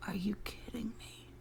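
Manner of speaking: hushed mocking disbelief; whispered scoff at the question; incredulous whisper. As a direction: whisper it back in scorn. Mocking the second woman.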